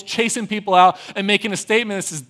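normal talking voice

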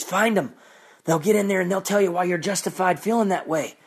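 normal talking voice

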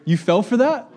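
high, loud disbelieving cry; you fell for that, really?; mock audience disbelief; incredulous. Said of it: "head nodding going".

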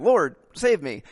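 loud high panicked plea; panicked cry. Retold or imitated. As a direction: shout it like men about to drown.

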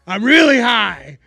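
loud, slow admission; loud confession. Retold or imitated. To the boss, loud slow admission. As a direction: blurt it out loud. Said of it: "And I go".

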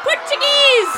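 yelled answer; shouting. Acted. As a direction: shout it back. Shouting back.

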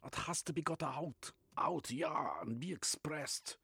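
put-on Reich in a German accent, eagerly expounding. Reich speaks, German-accented figure.

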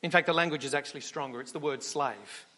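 ordinary talk, nothing marked

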